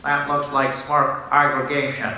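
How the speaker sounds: imitating a nasal-voiced colleague; mock nasal coworker voice; nasal colleague impression. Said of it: "very nasal voice".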